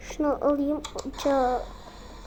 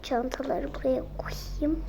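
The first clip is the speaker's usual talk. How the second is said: whispering; 2 semitones lower, 7 dB quieter than usual. Acted. Mother sneaking bags in quietly.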